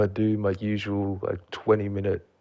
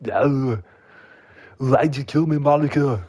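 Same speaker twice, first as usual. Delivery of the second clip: high, loud accusing ghost voice; ghostly voice; 5 semitones higher, 6 dB louder than usual. Retold or imitated. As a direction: wail it like a vengeful ghost. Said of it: "started hearing their voice in your head".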